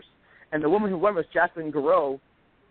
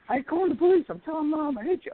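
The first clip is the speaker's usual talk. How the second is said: put-on childish angry protest; 12 semitones higher than usual. Kid chased by father, protesting.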